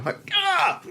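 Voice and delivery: high, squeaky frustrated "ah"; a kid's exasperated wail; mock tantrum yelp; frustrated child's cry.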